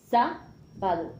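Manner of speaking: "Sábado" slowly, with a long first "Sá"; singsong syllables. Shown as a demonstration. Teacher shows pupils where the accent falls, "Sábado" in slow singsong.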